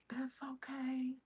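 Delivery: imitating a kind lady's high whisper; whispered reassurance. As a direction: hush and comfort the mother. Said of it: "She was like".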